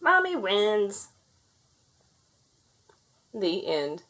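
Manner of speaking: ordinary delivery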